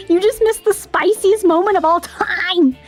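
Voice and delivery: high, giddy, gushing fangirl voice; cute anime streamer. Real, not acted. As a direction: squeal over the ship like a fan. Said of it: "cute anime streamer guess".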